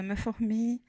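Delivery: normal talking voice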